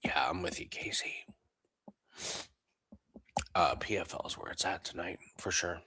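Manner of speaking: hushed whisper so as not to wake anyone. Real, not acted. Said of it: "I know this is strange that I'm whispering".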